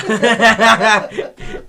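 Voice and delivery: real high, loud burst of laughter. Real, not acted. Laughing at his own war story, loud laugh. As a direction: crack up loudly at your own story.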